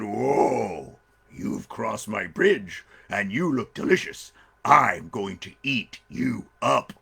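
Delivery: slow, drawn-out menacing bear; big storybook bear, very slow; hungry bear. Acted. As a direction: savour each word like a hungry bear.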